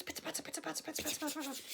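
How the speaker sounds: whispered pitter patter fading away; rain pitter-patter. Acted. Storyteller whispering rain noises for children, fading away. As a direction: patter softly like raindrops. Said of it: "loads of rain".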